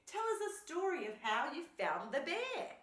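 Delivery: high, loud, excited plea; thrilled raccoon voice; eager request. A narrator's reading. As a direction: beg for the story with delight. Raccoon begs for a story.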